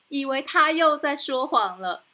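ordinary delivery

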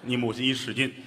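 ordinary delivery

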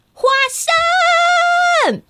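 high shout of a name; mother searching the house, calling her daughter. Acted. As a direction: call her child through the house.

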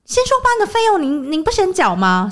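high, pushy mock demand; annoyed demand. Boss demanding the fee from the family. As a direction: imitate the irritated boss pressing. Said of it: "他就火大".

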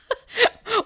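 high falling laugh. Real, not acted. Host laughs while mocking the boyfriend. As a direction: laugh in delight.

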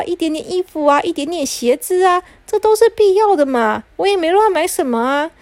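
high, loud, pleading and defensive; whiny excuses. Acted. Stepmother whining excuses to her husband about shopping. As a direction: whine that it was only a little.

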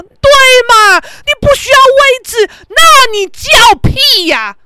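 high, loud, drawn-out jeer; shouted mockery. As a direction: yell it mockingly with long stretched endings. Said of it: "我大叫啊". (拉长尾音大叫嘲讽)

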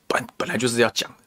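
a sly whispered aside. Real, not acted. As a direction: drop to a whisper as if sharing a secret. Aside, conspiratorial remark. (压低声音的耳语)

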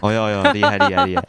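exaggerated amazed praise; mock awe. Teasing praise of Dabao.

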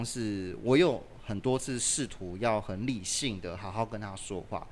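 ordinary talk, nothing marked

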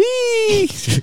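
high-pitched burst of laughter; a sudden squeal of a laugh; squeaky laugh. Real, not acted. 虾子游回去的笑. (尖声大笑)

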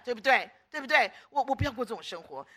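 usual speaking voice

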